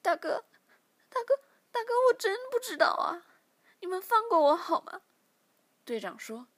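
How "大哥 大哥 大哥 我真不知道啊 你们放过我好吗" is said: high, loud tearful begging; crying plea. Acted. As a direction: sob out the plea, much higher and louder. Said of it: "小柔哭着说".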